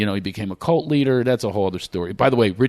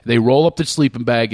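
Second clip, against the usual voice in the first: ordinary talk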